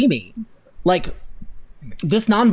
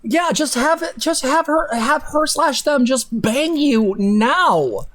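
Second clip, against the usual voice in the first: higher, exasperated sarcastic urging; mock exasperated advice; 6 semitones higher than usual. Streamer scoffing at the story couple, sarcastic. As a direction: toss off the advice with scorn.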